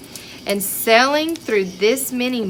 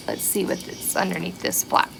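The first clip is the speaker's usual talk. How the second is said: whisper; 3 semitones lower, 6 dB quieter than usual. Acted. Secretive peek under flap.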